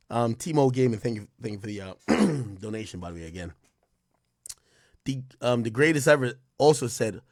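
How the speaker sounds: ordinary talk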